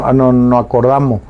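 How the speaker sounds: ordinary talk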